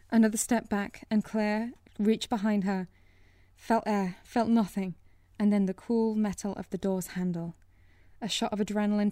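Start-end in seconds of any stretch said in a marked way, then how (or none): none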